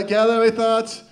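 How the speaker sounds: ordinary talk, nothing marked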